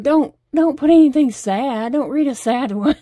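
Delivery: mock pleading with a laugh breaking in; half-laughing plea. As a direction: beg him off the sad ones, laughing. Begging husband not to read sad.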